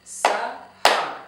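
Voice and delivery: SAHAR split into two even beats, Sa - har; syllable by syllable. Shown as a demonstration. Teacher translating a name into clapping, SAHAR in two separate beats. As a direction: clap out SAHAR with a gap between syllables. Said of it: "translated into clapping".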